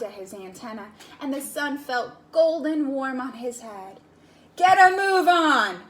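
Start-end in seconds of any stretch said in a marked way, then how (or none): 4.6-5.8 loud, high barked order; stern bark. Acted. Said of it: "barked a stern ant".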